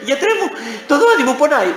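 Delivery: high, laughing patient's plea; hammed-up complaint about a toothache; mock whiny patient. Sermon joke, a patient whining to the dentist.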